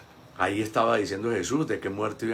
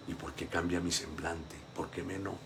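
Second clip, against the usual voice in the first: quiet self-questioning; 5 semitones lower, 13 dB quieter than usual. Shown as a demonstration. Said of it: "usted tiene que preguntarse".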